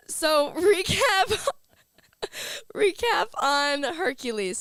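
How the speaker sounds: real laughter breaking through the words; laughing out loud as she starts the recap. Real, not acted.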